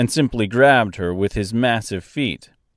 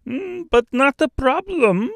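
high-pitched Austrian-French bird voice; Austrian-French accent. A narrator's reading. Stork replying to Dorothy's worry, high Austrian-French accent. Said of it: "in a strangely thick, almost Austrian accent".

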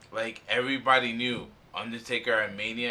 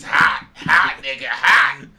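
from firm to much louder shout on hot; building shout; 2 semitones higher, 12 dB louder, slower than usual. Real, not acted. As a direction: hammer the word hot louder each time. Shouting his point, building.

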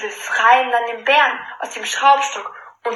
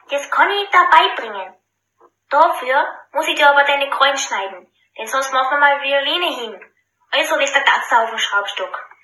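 lightly voiced tailor; 3 semitones higher than usual. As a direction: coax the bear slyly. Clever tailor instructs. (leicht gespielter schlauer Schneider)

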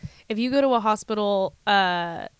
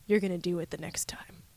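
soft, breathy, unvoiced doctor's whisper; retold whisper; 3 semitones lower, 8 dB quieter than usual. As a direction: lean in and whisper the promise to her. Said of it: "and he was like".

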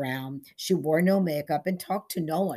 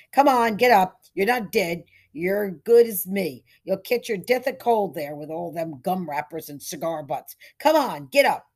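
roust him with your voice; loud coaxing; 2 semitones higher, 7 dB louder than usual. Acted. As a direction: sheriff loudly coaxing a man to get up. Sheriff prodding man in gutter.

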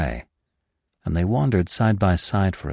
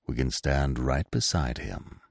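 put-on soft, low old stag voice; 6 semitones lower, 6 dB quieter than usual. A narrator's reading. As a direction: speak softly beside the body. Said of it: "the old stag began softly".

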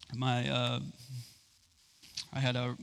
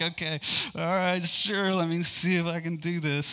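higher and louder, eager yes; eager agreement; 4 semitones higher, 5 dB louder than usual. Retold or imitated. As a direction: jump in keen to try it. Retells eagerly agreeing to God.

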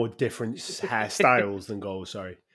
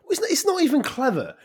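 exasperated; 4 dB louder than usual. Real, not acted. Exasperated at documentary name.

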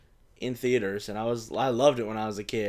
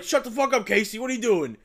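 Raphael snapping; 10 semitones higher, 8 dB louder than usual. Retold or imitated. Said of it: "And then he's like".

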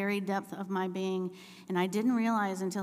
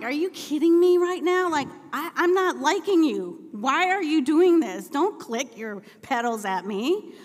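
loud, high-pitched exasperation; mad; 8 semitones higher, 9 dB louder than usual. Retold or imitated. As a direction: scold your cruising husband in mock outrage. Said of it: "I am mad".

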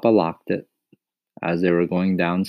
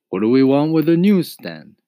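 high, loud echoing question; rhetorical retort; 9 semitones higher, 7 dB louder than usual. A narrator's reading. Papa echoes Mama's question back at her, retorting. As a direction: throw the question back, rising then falling.

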